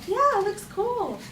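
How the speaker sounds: high, drawn-out mock-casual agreement; cool nonchalant agreement. Friend agrees to try stage fighting, putting on cool nonchalance. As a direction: draw it out, pitched well up.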